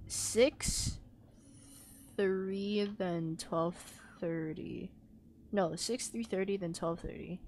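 soft low counting; muttering. Real, not acted. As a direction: murmur the numbers to yourself. Working out code quietly.

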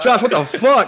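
high, loud appalled outcry; imitated shock. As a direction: act out a visitor aghast at the sight. Acts out visitors' shock at teeth.

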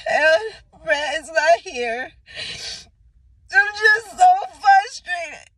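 crying out of frustration; sobbing frustration on her birthday eve. Real, not acted. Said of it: "when I get angry, I cry".

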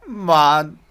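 high, drawn-out furious yell; outraged screaming. Pretend. Player yells at the monster flying away from the fight, outraged screaming. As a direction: scream in hammed-up betrayal.

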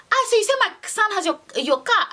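high, fast mock auntie voice; lightly voiced surprised mother; imitating auntie. Mother questions the driver about her son, high and fast. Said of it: "she's like".

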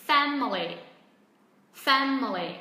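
"Family" with loud FAM, then falling; stress on first syllable. Shown as a demonstration. Teacher models for learners, strong first-syllable stress on "Family". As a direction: punch FAM in "Family", drop the rest. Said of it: "Trọng âm rơi vào âm tiết thứ nhất".

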